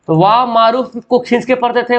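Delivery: ordinary talk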